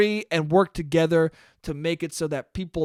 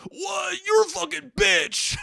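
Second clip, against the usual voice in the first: snapping into a high screaming rage; nice guy suddenly screams abuse; sudden furious yell; 12 semitones higher, slower than usual. Retold or imitated. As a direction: flip from nice to vicious, yelling. Nice guy turns on her.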